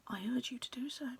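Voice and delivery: hushed, secretive aside to the reader; conspiratorial whisper. A narrator's reading. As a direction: whisper it like a secret only we share.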